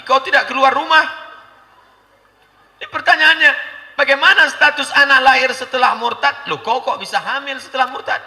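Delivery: loud, high, scolding sermon; fiery preaching. Acted. Preacher scolds women about living with apostate, loud. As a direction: hammer the point at the women.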